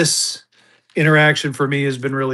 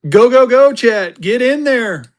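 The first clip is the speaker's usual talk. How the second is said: higher and louder, hyping them up; excited cheering; 8 semitones higher, 5 dB louder, slower than usual. Real, not acted. Auctioneer urging his chat to bid, excited cheer.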